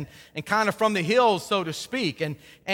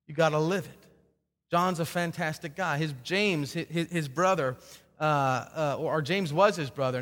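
usual speaking voice

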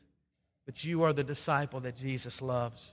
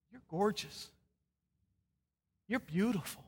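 higher, softly spoken tenderness; tender assurance; 6 semitones higher, 4 dB quieter than usual. Acted. Jesus speaking to you, tender and hushed. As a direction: tell them gently, with space after each phrase.

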